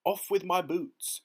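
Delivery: loud, high, slow command; shouted. A narrator's reading. Centipede afraid to swim in boots, shouting. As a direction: shout it out urgently. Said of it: "shouted the centipede".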